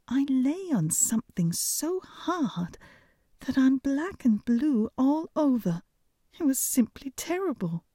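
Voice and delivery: a hint of a dainty princess complaining; cartoon princess. A narrator's reading. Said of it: "said the princess".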